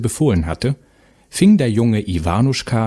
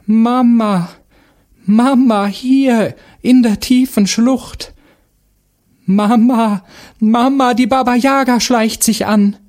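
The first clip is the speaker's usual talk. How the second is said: put-on high, loud children's cries for mother; desperate calls from hiding; lost sons call to their mother for help; boys calling from afar; 13 semitones higher, 5 dB louder, slower than usual. A narrator's reading. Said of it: "die Stimmen der vermissten Jungen".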